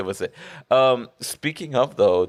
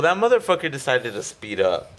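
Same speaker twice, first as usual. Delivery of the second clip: ordinary talk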